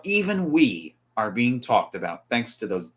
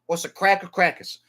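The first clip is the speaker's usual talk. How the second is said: higher-pitched mock Boston accent; playful r-less Boston accent; 6 semitones higher than usual. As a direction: ask it like a caricature Bostonian. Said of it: "if you're from Boston".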